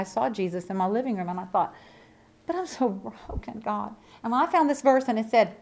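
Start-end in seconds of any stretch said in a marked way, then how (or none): 2.5-3.9 quiet retold prayer.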